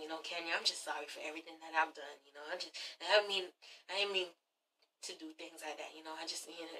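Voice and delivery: low, soft, halting murmur; hushed apology. Retold or imitated. Matt softly apologizing to Kenya, hushed. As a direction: whisper a sorry. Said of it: "He's like".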